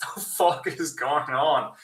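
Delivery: ordinary talk, nothing marked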